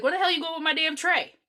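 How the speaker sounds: indignant lunch lady calling out a student; scolding lunch lady. Retold or imitated. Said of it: "the lunch lady was like".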